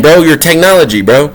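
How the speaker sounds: ordinary talk, nothing marked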